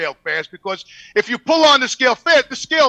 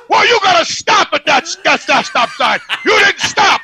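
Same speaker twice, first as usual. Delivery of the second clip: loud, higher, laughing scold; mock angry scolding; 7 semitones higher, 7 dB louder than usual. Scale guy yelling at the driver, mock shout. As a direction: bark it like the crabby scale guy.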